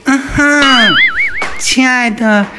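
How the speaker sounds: cutesy, drawn-out effeminate whining; hammed-up simpering sweetheart voice; sissy act. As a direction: play exaggeratedly girly and coy, very slowly. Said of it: "我变得比你还娘".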